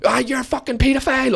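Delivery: high-pitched mock scream at moderate volume; retold screaming. Woman accuses teller mid-rant, mock scream. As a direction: imitate her screaming an accusation, not full force. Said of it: "screaming at the top of her lungs".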